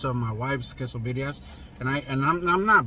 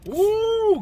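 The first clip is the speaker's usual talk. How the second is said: sharp high-pitched yelp of pain; pained high ooh; 5 dB louder than usual. Real, not acted. Reacting to burning hot broth.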